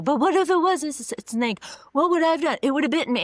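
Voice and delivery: high, loud panicky rambling with a stammer; mock freak-out. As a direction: act the scared teen who can't let it go. Teen voiced freaking out.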